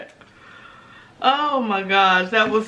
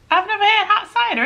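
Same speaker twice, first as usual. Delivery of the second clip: "I've never had hot cider" lifted higher, amazed at herself; surprised; 10 semitones higher than usual. Real, not acted. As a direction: say "never had" like it just dawned on you. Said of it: "Isn't that weird?".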